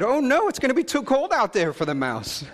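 worried little girl, voiced with a laugh; high child voice with a laugh. Voicing daughter worried for mouse.

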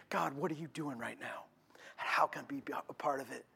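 soft breathy whisper; whispered prayer. Pretend. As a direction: pray it under your breath.

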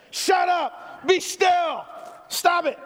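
yelling mom from the front seat; angry shout. Retold or imitated. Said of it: "smack us".

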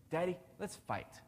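a hint of a grinning kid daring his dad; little son. Dad imitating his son's first words today, lightly childlike. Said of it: "What did he say when he got here today".

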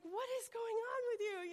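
high, loud imitation of a bewildered onlooker; incredulous retold question; baffled exclamation. Acts out Jim's baffled question.

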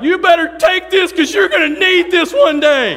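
high, full-volume imitated scream. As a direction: scream it as a warning hurled after someone. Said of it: "and he screamed out".

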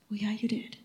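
nearly whispered admission; hushed concession. Retold or imitated. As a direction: concede it under your breath. Sheepish concession in retold exchange with God.

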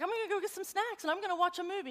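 put-on high, mumbly teen daughter; lightly voiced sleepwalking girl. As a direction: play the half-asleep kid. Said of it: "She's like".

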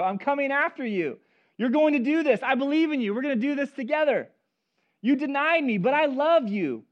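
rally him like a coach who won't give up on him; Jesus encouraging. Acted. Jesus speaking to Peter, encouraging.

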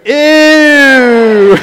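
long, high, loud mock groan of disgust. Two in the pews reacting to pastor's slip, hammed-up disgust. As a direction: draw out a loud, grossed-out ew.

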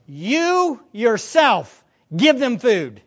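loud, slow, weight on "You yourself"; emphatic command. Acted. Jesus commanding his disciples, "You yourself" heavily stressed. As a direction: set "You yourself" apart slowly, then punch "give them food". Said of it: "that's an emphatic way".

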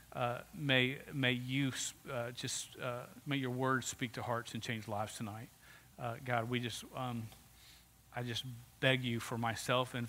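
low, hushed, halting prayer. Real, not acted. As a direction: pray softly and humbly, feeling for the words. Leads a prayer.

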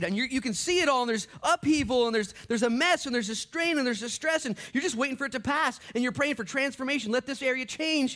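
loud, high preacher's build; preaching at full force. Acted. Preaching climax.

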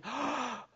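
big mock gasp of surprise; sharp, thrilled intake of breath; delighted gasp. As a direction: gasp like a kid opening a present. Child opening a gift.

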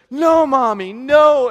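high, loud wailing no; a little boy losing it, half imitated; child's tantrum. Son protesting to mommy, loud high tantrum cry.

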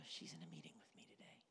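secret excited whisper. Retold or imitated. Man checking his calendar in a guilty whisper. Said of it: "looking at our calendar going".

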